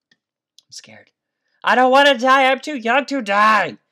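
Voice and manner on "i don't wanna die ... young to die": high, loud mock-desperate wail; hammed-up fear. Real, not acted. Gamer wailing in mock fear as enemies close in. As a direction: overplay the terror of dying. Enemies climbing toward her.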